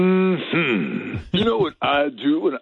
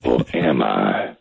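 ominous low voice. Acted. Ominous joke after "just kidding.

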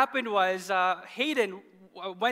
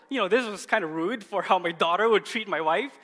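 ordinary delivery

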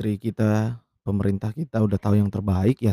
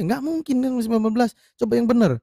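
exasperated scolding; 11 semitones higher than usual. Retold or imitated. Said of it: "gua bilang gitu aja".

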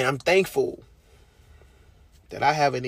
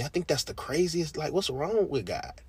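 usual speaking voice

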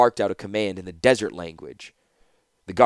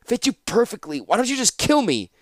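much higher, strained retort; lightly gasped defiance, pitched high; 13 semitones higher, 3 dB louder than usual. Acted. Tortured prisoner taunts his captor, mildly gasping. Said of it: "gasped Tanos".

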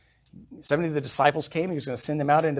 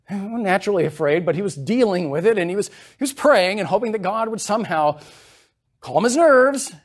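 building preaching; 6 semitones higher, 6 dB louder than usual. Acted.

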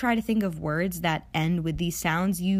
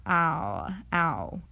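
ow, ow said quickly as one glide; quick diphthong. Shown as a demonstration. Teacher shows the quick take, one glide. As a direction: snap it together into ow. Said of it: "Say this one slowly and then quickly".

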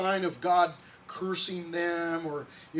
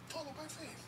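much softer, higher murmured repeat; soft echo; 9 semitones higher, 16 dB quieter than usual. Real, not acted. Pastor repeating his point to the congregation, hushed. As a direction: let "Follow by faith" fall to a hush.

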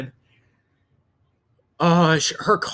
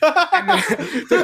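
laughing; 12 semitones higher, 4 dB louder, much slower than usual. Real, not acted. Laughing at own story.

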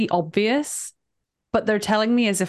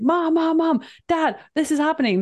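panicked calling; 7 semitones higher than usual. Retold or imitated.

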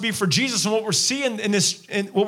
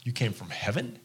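softer, lower, unvoiced scoffing whisper; sneering whisper; 8 semitones lower, 7 dB quieter than usual. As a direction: hiss the doubt under your breath. Mocking crowd voiced after Jesus.

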